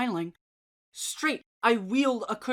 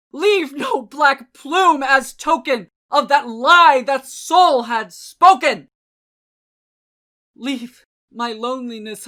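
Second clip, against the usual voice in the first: furious command; 4 semitones higher, 10 dB louder than usual. A narrator's reading. Enraged man banishing the raven, loud furious command. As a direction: drive the bird out of the house. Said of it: "I shrieked".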